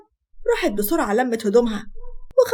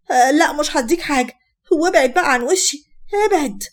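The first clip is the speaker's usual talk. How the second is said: higher, louder acted defiant yell; 4 semitones higher, 6 dB louder than usual. Wife refusing to hand husband the phone, yelling back. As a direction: refuse loudly, pushing them away. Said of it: "غادة بزعيق وصوت عالي".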